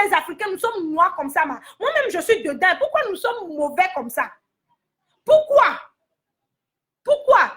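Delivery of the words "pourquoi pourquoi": louder, higher outraged why; indignant cry. Real, not acted. A singer scolding TV hosts, crying out why. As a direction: throw the question out in outrage.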